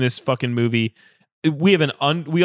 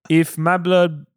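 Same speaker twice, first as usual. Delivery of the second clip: put-on French accent, cracking up; a French accent breaking into laughter; trying to hold a French accent through giggles; mock French accent; 3 semitones higher, 3 dB louder, slower than usual. Shown as a demonstration. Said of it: "I can't do French".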